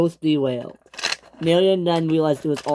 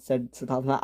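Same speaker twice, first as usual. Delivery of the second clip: plain reading voice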